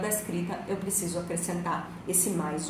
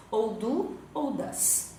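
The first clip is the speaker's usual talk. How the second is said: slow model words; much slower than usual. Shown as a demonstration. Teacher to students, drawing out the options slowly. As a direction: do and does drawn out slowly.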